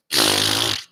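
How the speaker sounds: big raspberry. Acted. Leprechaun answers the Englishman's insult, big raspberry. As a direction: blow a long raspberry. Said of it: "big raspberry".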